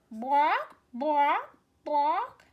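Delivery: loud clucking bwok bwok bwok; hen clucking. Acted. Teacher voicing the hen for a child, loud clucks. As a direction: cluck like a hen, rising each time. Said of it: "the girl chicken says".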